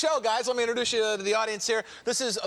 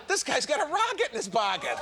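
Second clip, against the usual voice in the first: laughing, raised voice; laughing quote; 5 semitones higher than usual. Retold or imitated. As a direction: crack up while quoting the line. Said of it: "another lady said".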